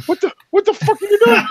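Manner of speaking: high startled yelp, imitating himself; alarmed shout. Son yelling at his drunk father swinging a two-by-four, high and startled. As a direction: shriek it, caught off guard.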